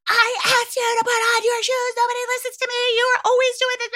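very high, fast, fed-up mock outburst; exasperated parent. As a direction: parent finally snapping about the shoes. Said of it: "we know what's coming".

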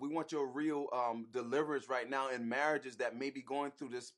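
quiet, earnest prayer voice; praying. Acted. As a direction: pray it softly, from the heart. Husband leads an opening prayer.

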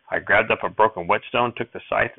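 plain reading voice

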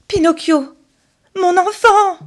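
high, loud frantic calling; panicked cry. Acted. Geppetto finds his son lying lifeless, panicked cry. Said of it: "crie Geppetto affolé".

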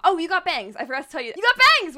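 loud, high delight, building to a shout; excited exclaiming. Real, not acted. Co-host notices friend's new bangs, excited exclaiming.

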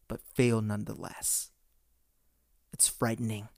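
measured, ominous monologue in short, weighty phrases; Thanos. Acted. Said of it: "do my best, uh, Thanos impression".

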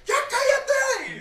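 very high, loud laughing yell; shouted. Retold or imitated. Lady caller screaming at the agent, high shout. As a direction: scream it, drawn out. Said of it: "Y gritó así".

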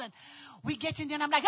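usual speaking voice

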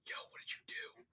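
whispered mock confrontation; acted whisper. Parent upstairs secretly questioning the kid, acted whisper. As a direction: ask it in a hushed, voiceless whisper.

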